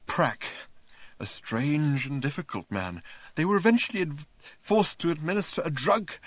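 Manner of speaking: a lightly voiced wild-eyed journalist; raving journalist. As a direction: rave feverishly, half mad, stumbling.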